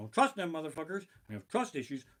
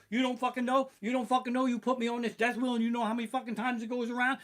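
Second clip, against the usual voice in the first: higher, fast, outraged yelling; mock furious; 9 semitones higher, faster than usual. On the death wheel, ranting at wife. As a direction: rail at her in mock outrage.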